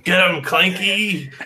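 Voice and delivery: high, slow drawn-out cry; hammed-up battle call; rallying call. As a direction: urge your partner into battle. Character urges partner on to attack.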